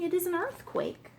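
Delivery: high-pitched cry of warning; alarmed. A narrator's reading. King warns the detective of danger, alarmed.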